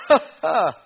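loud, high fake "ha, ha"; mock laugh. Preacher imitating someone hearing a funny joke, mock laughing. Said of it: "somebody tell you a funny joke".

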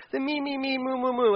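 mocking singsong. Mocking self-focus.

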